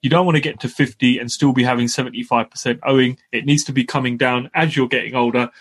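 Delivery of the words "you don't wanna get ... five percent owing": ordinary talk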